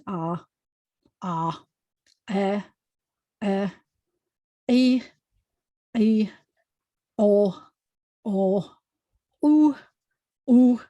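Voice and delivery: slow teacher drill of the vowels; guttural vowel series; ayin vowel drill. Shown as a demonstration. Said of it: "start at the beginning".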